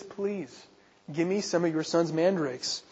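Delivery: asking softly and politely for the mandrakes; gentle, polite plea. Retold or imitated. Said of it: "she asks gently, she asks politely".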